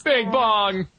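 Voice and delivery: high mournful cry of the name; hammed-up sorrowful Bing Bong; mock grief. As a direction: lament him playfully.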